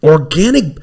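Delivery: hammed-up disbelief, drag it out; mock outraged. Telling a friend about Costco sheets, hammed-up outrage. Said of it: "I go".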